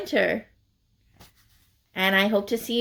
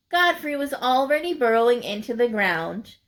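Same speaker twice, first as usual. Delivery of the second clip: usual speaking voice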